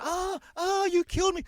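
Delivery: shrieking Karen playing victim on the ground; mock dying wail. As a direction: ham it up like a woman faking a fatal injury. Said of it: "she's rolling around on the ground".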